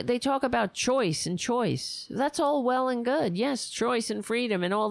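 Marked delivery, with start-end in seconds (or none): none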